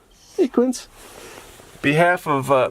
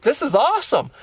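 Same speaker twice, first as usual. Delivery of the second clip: put-on higher-pitched kid's excitement; delighted niece; 10 semitones higher than usual. Niece seeing the camper van for the first time, delighted. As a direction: imitate a thrilled ten-year-old.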